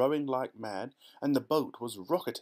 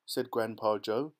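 ordinary delivery

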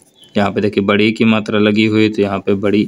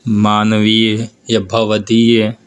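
slow demo words; much slower than usual. Shown as a demonstration. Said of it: "तो यहाँ पे बड़ी ई आई हुई।".

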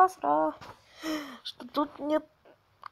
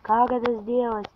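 usual speaking voice

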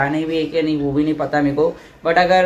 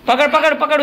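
quick raised repeated orders, pitched up; urgent calling out; 9 semitones higher than usual. Real, not acted. Vlogger urging kid to grab goat's leash, raised urgent calling.

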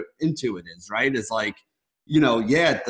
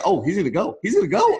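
re-enacted fan excitement, leaping much higher; retold rising excitement; 5 semitones higher, 3 dB louder than usual. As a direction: build to a high cry as if watching the runner break free. Re-enacts watching the breakaway run.

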